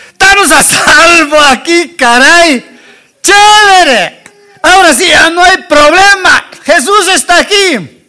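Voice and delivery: high, loud, jubilant outburst; elated relief. Acted. What Peter should say on seeing Jesus, elated relief.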